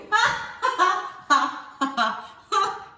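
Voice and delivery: cracking up at herself; loud giggling fit; high burst of laughter. Real, not acted. Reader laughing at her own silly accent.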